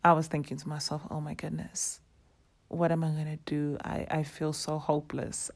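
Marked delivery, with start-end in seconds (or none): 1.1-5.5 near tears. Retold or imitated. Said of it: "I was on the brink of tears".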